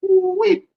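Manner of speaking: high, whooped woo-wee; imitated woo-wee. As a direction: mimic a teammate's high whoop.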